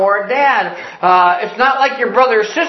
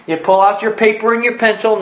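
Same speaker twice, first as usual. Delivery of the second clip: ordinary talk, nothing marked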